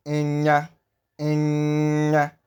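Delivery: "enya" with a long nasal ny. Shown as a demonstration. Teacher modelling the digraph, lengthened ny in enya. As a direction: hold the nasal in "enya" before releasing the a. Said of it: "I'm pronouncing the N Y digraph".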